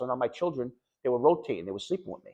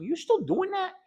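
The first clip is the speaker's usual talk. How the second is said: much higher, teasing wife's question; slower than usual. Retold or imitated. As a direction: mimic the wife ribbing him, pitched way up. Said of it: "My wife's like".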